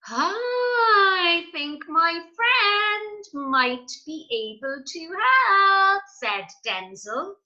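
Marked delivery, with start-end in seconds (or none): 0.1-6.0 high, very slow sing-song; character voice. Acted. Denzel offering his friend's help, slow and sing-song. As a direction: stretch it out high and singing.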